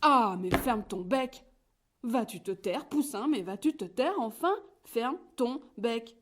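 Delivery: whole farmyard yelling at the chatterbox; shouting in chorus. A narrator's reading. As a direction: bellow at the chick to shut up. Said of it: "se mettent à crier".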